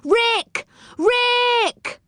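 high, drawn-out calling shout; high-pitched holler, held longer the second time; shouting a name. A narrator's reading. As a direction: call out for someone far up above. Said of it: "Everyone began to shout".

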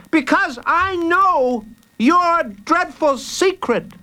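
put-on slow, very high child voice; defiant child. Jacob confronts Hooded Fang with his secret, defiant.

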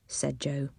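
ordinary delivery

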